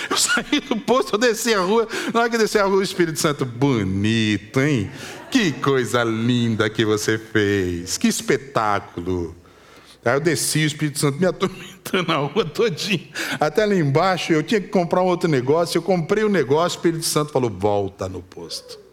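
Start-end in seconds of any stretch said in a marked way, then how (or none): 3.4-9.4 slow, low mock praise; sarcastic reproach. Holy Spirit voiced reproving him sarcastically. 17.5-18.8 low, slow, soft order; quiet divine command. Retold or imitated. Holy Spirit voiced giving a command.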